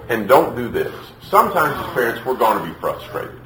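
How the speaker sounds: ordinary talk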